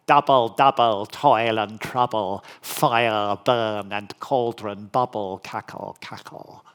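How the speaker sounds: mock-witch chant, fading away at the end; recited Shakespearean witches' jingle, dropping to a hush on the last words; witches' incantation. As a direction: chant it like a stage witch, then trail off. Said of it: "Shakespeare's incantation with the jingle".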